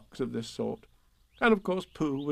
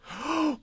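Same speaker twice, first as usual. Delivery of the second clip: delighted gasp. Acted. Pooh overwhelmed by gift.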